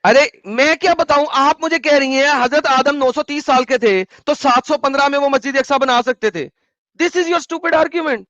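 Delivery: high, loud, exasperated yelling; angry shouting. Real, not acted. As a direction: shout her argument down in disbelief. Heated livestream argument, shouting back. (ऊँची आवाज़ में गुस्से से चिल्लाते हुए)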